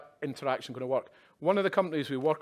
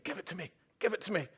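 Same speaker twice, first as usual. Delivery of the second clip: quick, higher, grabby hammed-up eagerness; mock eager plea; 6 semitones higher, 3 dB quieter, faster than usual. Quoting eager AI staff to the audience, hammed-up eager begging. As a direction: ham up staff begging for the tech. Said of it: "she didn't quite say that, so sort of lays it up a bit".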